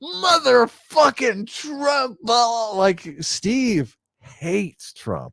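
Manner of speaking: high, drawn-out mock drunken wail; hammed-up drunk outburst, stretched out; drunken rant. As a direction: imitate a drunk friend ranting, slow and way up high. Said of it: "when you were obviously drunk".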